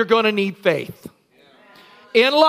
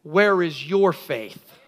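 gentle question; 7 semitones lower, 4 dB quieter, slower than usual. Acted. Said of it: "And he said".

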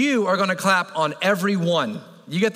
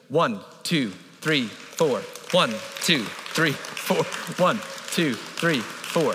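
even, lower counting beat; steady cadence; 3 semitones lower, much slower than usual. Shown as a demonstration. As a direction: keep a slow steady count. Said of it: "I'm gonna give you a cadence".

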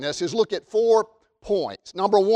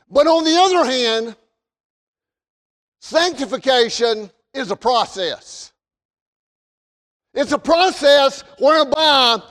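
high, projected sermon voice; forceful pulpit delivery with punched key words; emphatic preaching; 6 semitones higher, 5 dB louder than usual. Real, not acted. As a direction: drive each point home to the congregation.

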